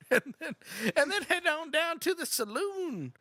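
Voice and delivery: put-on high, slow old-prospector voice; old working man. Old working man rallying others to pan gold, high slow drawl. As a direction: draw it out as a comic old-timer. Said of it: "like, he's a working man".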